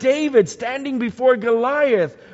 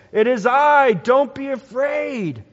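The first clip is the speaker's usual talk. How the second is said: calling out; 6 semitones higher, 5 dB louder than usual. Acted. Jesus calling to frightened disciples.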